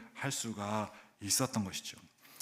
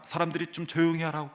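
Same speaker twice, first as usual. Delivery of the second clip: ordinary delivery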